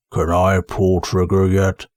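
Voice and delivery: low, slow troll voice; slow low rumble of a dim troll. Acted. Detritus rumbling into the armorer's ear, low and slow. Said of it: "Detritus rumbled into the man's ear".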